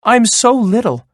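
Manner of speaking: very high little-goat voice; pleading. A narrator's reading. Little goat begging to be spared, high pleading. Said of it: "pleaded little Billy".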